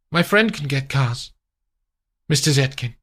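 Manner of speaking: mild servant voice; a hint of the chauffeur. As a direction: hand the clothes over. Chauffeur offering.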